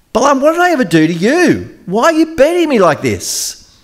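high-pitched, aggrieved donkey voice; talking donkey. Acted. Donkey protesting to Balaam about beatings, high and aggrieved. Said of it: "starts to speak".

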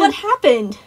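put-on loud, high little-boy interruption; piping child. A narrator's reading. Boy cutting in with a question, piping up. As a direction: pipe up over the grown-up. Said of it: "Tyler piped up".

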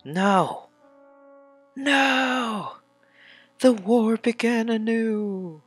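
high, loud, drawn-out mock wail; hammed-up dramatic cry. Player wailing over the war starting anew, hammed-up dramatic cry. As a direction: cry it out high like an overblown epic. Dramatic reading of game cutscene.

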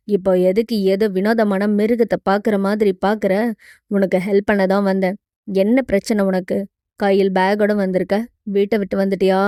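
low-voiced hero firing off questions; hero, impatient questioning. Hero questions frightened girl. (கதாநாயகனின் தாழ்ந்த குரலில் அடுக்கடுக்கான கேள்விகள்)

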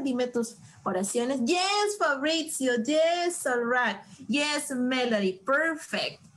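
ordinary talk, nothing marked

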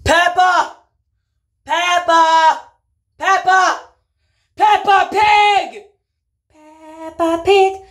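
calling out. Acted. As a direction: call her across the house. Calling out for lost Peppa.

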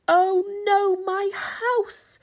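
high, loud despairing yell. A narrator's reading. Beaver sees his house collapse, yelling. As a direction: shout at the ruined house. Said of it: "yelled Beaver".

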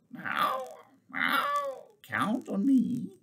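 very high mewing cat voice; cat's meow. A narrator's reading. Cat assuring the captain, high feline mewing. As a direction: mew it, then draw the words out like a cat.

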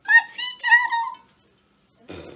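high, loud delighted shriek; excited shriek. Real, not acted. To viewers, revealing her favorite purchase, a loud excited shriek. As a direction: scream it out like the best gift ever.

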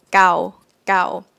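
gao short and crisp. Shown as a demonstration. Teacher repeating short gao for students. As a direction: keep gao clipped. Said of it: "Short version".

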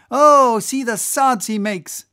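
loud, high-pitched exclamation; excited cry. Acted. Whaler watching the whale's suds, excited cry. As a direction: cry out as if dancing up and down. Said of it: "cried Flask, dancing up and down".

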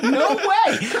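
very high, loud mock amazement; mock disbelief. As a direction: fake astonishment to needle him. Retold taunting bar guy.

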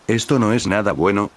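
plain reading voice

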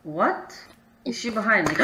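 normal talking voice

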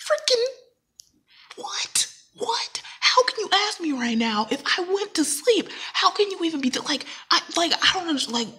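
an affronted rant, voice up high; indignant outburst. Retold or imitated. Snapping at a friend who asks about sleep, high indignant outburst. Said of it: "I'm kinda mad".

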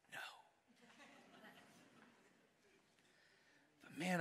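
soft, sincere father's love; hushed tender confession; tender and quiet. Real, not acted. Tender talk about his kids.

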